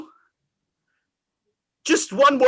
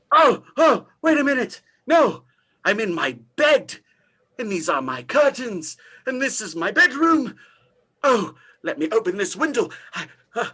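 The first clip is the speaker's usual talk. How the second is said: usual speaking voice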